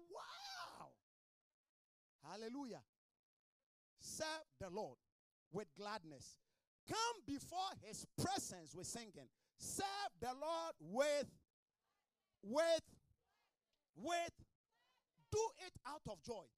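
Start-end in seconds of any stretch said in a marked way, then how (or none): 0.1-0.9 high, drawn-out wow; retold cry of amazement, pitched way up; amazed wow. She marvels at who was singing, amazed. Retelling listener's amazed exclamation. 6.9-16.4 loud, high preaching, slowed and drawn out; forceful preaching. Real, not acted. Preacher urges congregation to serve out of joy, forceful.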